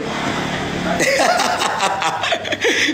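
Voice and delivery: high breathless mocking laugh. Real, not acted. Laughing at Jimmy Phương on stream, high breathless laugh. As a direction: laugh at her, airy and high.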